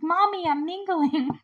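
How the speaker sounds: put-on high, slow little-kid whine to mommy; drawn-out, high-pitched child impression; little girl voice. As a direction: imitate a little girl protesting to her mom. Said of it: "then there's this little girl".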